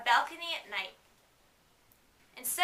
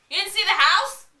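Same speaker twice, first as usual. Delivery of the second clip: loud incredulous question; surprised; 7 semitones higher, 13 dB louder than usual. Real, not acted. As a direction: ask in disbelief. Reader's surprised aside.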